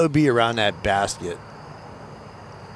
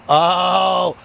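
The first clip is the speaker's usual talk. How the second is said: sudden excited outburst, higher and louder; 7 semitones higher, 5 dB louder, much slower than usual. Real, not acted. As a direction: cry out as the ball bounces back to safety. Ball saved by tree.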